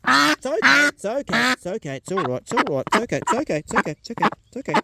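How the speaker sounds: high, gentle reassurance; calm the frightened duck; soothing. Real, not acted. Farmer holding his duck while clearing its beak, soothing.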